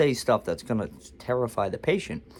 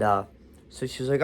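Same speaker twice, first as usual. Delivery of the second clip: ordinary delivery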